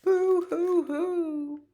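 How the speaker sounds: high, drawn-out boo-hoo; mock crying. Somebody in the story cries, high mock wailing. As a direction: wail in pretend sobs. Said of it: "Somebody's crying".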